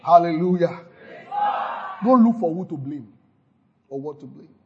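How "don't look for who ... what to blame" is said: ordinary delivery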